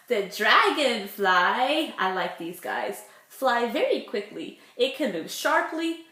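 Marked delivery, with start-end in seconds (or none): none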